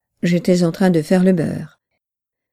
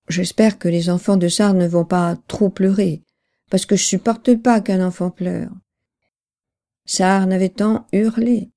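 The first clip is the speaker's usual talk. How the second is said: ordinary delivery, nothing marked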